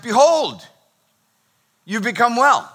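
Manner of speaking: high, loud scriptural announcement; grand proclamation. Acted. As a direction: proclaim it like a biblical pronouncement. Acting out Jesus addressing healed man.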